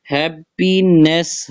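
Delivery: Happiness split as hap, pi, ness; three distinct beats on happiness; syllable by syllable. Shown as a demonstration. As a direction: sound out each syllable of happiness. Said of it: "ये भी three syllable है".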